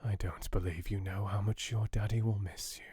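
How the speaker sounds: soft unvoiced whisper to a sleeping child; tender fatherly whisper. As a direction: breathe it so she won't wake. Father murmurs over sleeping child.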